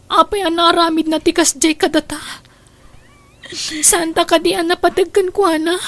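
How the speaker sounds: put-on a child crying to her mother about the father leaving. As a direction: let the sobs break the words.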